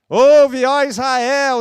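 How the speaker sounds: booming high shout; shouting a prayer over the group; loud prayer. Retold or imitated. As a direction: bellow it over the others. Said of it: "alguém bem alto que começa a falar".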